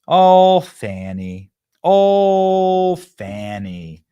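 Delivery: hammed-up sigh over someone's name; drawn-out mock dismay, dropping away on the name; mock lament. Host mockingly laments Fannie's name.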